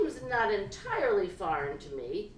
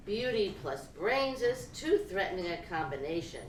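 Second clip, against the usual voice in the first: ordinary delivery, nothing marked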